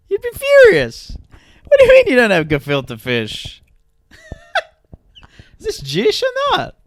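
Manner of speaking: hammed-up furious diner, high and loud; mock outrage. Diner furious about missing gefilte fish, mock outrage. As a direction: play the offended customer cracking up.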